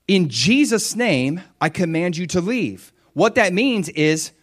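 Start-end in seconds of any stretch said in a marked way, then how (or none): 0.1-2.9 command in Jesus' name. Shown as a demonstration. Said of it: "But when I say".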